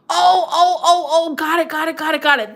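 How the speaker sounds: loud, high burst of repeated words; sudden realisation. Real, not acted. Finally getting the viewer's comment, excitedly. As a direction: burst out as it dawns on you.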